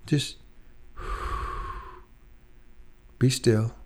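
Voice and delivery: a soft sigh, then a breathy whispered hush. Retold or imitated. The Lord inviting a struggling believer to rest, sighed whisper. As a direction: breathe it out like calming a tired friend.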